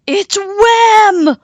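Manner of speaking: high, loud panicked shriek; wolf crying out in fright; terrified cry. A narrator's reading. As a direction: cry out in terror. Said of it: "cried the wolf".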